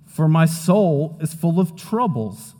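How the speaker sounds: slow earnest reading; scripture reading. Acted. Pastor reading verse three aloud, slow and earnest. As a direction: read the verse with weight. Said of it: "Verse three".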